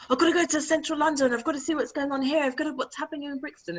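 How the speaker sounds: high, hurried mock eagerness; restless craving. Man mocking the urge to go into town, restless and rushed. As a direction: rattle it off high like you can't wait.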